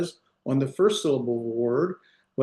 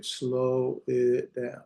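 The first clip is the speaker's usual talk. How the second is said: drawn-out "slow it down"; 3 dB quieter, much slower than usual. Shown as a demonstration. Teacher telling learners they can slow it, drawn out. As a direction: stretch the words out slowly. Playfully stretches words about slowing.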